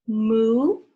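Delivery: loud mooing like a cow. Shown as a demonstration. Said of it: "What does a cow say?".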